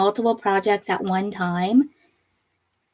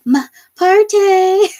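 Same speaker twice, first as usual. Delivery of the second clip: laughing; 9 semitones higher, 6 dB louder, much slower than usual. Real, not acted. Reading comment, laughing.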